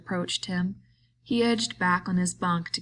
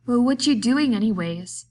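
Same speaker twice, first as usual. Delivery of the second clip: louder, higher, agitated cry; Lenny turns on Crooks in alarm; 5 semitones higher, 7 dB louder than usual. A narrator's reading. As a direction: lash out in alarm. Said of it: "he cried".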